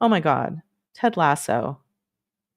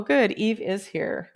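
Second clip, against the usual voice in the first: usual speaking voice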